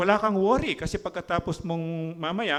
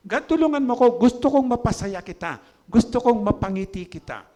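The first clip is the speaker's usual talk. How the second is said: eager prayer; 7 semitones higher, 6 dB louder than usual. Acted. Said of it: "pupunta ako doon".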